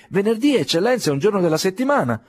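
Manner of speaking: high and fast flustered clerk; hurried explanation. Acted. Flustered clerk explaining to the dignitary, high and fast. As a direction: rush the explanation nervously.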